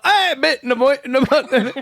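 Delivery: high, garbled British mumbo-jumbo; high mock-British babble breaking into laughter; mock British accent. Imitating the kid who got bumped, mock British accent. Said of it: "And David was like".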